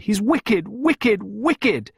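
high, loud, hammered repetition; desperate. A narrator's reading. Pippa denouncing Oliver to Clarissa, desperate. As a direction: cry each word out in desperation. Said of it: "Pippa exclaimed desperately".

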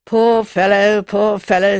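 loud, lightly voiced man's sighing sympathy; sighing pity. A narrator's reading. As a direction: sigh it out with a touch of pity. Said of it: "said Mister Eccles with a sigh".

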